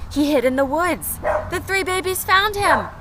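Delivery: normal talking voice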